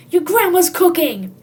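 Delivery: loud, high, slow realization; excited. Acted. Hungry kid remembering grandma, loud and excited. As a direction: announce the big idea.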